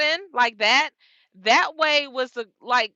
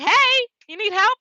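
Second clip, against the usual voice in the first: high, louder friendly call, imitating the other driver; helpful trucker voice; 10 semitones higher, 5 dB louder than usual. Other trucker offering help, higher friendly call. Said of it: "He like".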